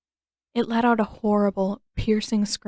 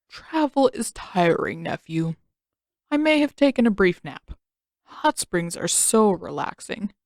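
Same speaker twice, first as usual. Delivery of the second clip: lightly voiced relaxed uncle. A narrator's reading. Sleepy Uncle after a nap.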